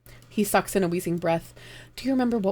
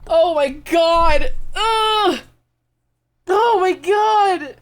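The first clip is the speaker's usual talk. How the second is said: high, loud, slow crying release; real sobbing wail; 12 semitones higher, 8 dB louder, much slower than usual. Real, not acted. GM breaks down crying after the scene, wailing loudly. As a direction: let the held grief burst out in wails. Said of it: "That one was hard!".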